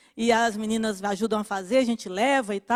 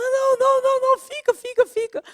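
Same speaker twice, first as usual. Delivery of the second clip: much higher, pleading voice of the crying neighbor; tearful neighbor; 14 semitones higher, faster than usual. Retold or imitated. The neighbor urging the visitor to stay, high and tearful. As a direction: beg her to stay, voice pitched way up. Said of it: "e começou a chorar".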